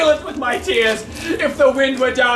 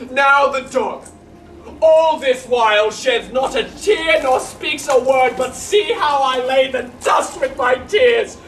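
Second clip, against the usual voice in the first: rueful clown lamenting his dog; comic servant Launce. Acted. Launce to the audience, a mock-tearful clown. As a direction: play the weeping fool for laughs.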